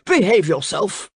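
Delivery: sharp scolding exclamation; mildly outraged reprimand; crying out. A narrator's reading. King scolding the snapping crocodile, crying out. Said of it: "cried the king".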